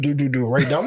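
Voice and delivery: usual speaking voice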